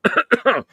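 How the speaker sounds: loud throat clearing cough. Real, not acted. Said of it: "clear my throat".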